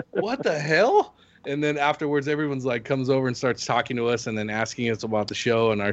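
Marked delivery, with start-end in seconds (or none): 0.1-1.1 surprised. Retold or imitated. Retold stunned reaction.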